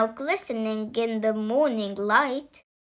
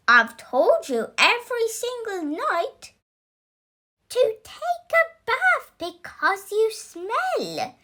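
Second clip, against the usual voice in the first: scolding mother; 8 semitones higher than usual. Acted. Mother scolds smelly son.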